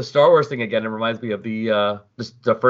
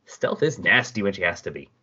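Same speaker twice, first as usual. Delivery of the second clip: ordinary talk, nothing marked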